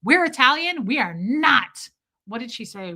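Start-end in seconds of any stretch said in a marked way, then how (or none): 0.0-1.9 imitating grandma's high, loud correction; indignant grandmother. Host imitating grandmother correcting others, indignant. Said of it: "it sounds like my grandmother being like".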